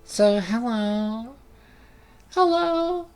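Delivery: hello climbing into a high pitch. Shown as a demonstration.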